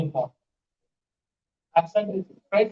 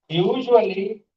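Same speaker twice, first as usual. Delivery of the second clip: ordinary talk, nothing marked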